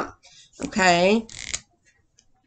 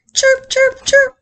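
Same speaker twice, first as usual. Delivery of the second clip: very high, loud chirping; tiny chick peeps; squeaking chicks; 7 dB louder, slower than usual. Acted. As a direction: squeak like baby birds. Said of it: "squeaked the chicks".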